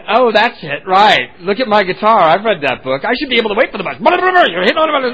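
high, loud tirade building into babble; hammed-up offended ranting; mock angry rant. Retold angry rant before storming off.

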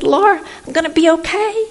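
high relieved announcement; excited. Retold or imitated. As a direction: sing it out with relief. Calling daughter with paycheck.

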